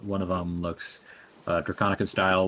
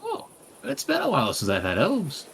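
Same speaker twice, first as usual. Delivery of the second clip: put-on high, creaky old dragonborn greeting; old person voice; 10 semitones higher than usual. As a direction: welcome them like a frail old shopkeeper. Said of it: "or an old person voice".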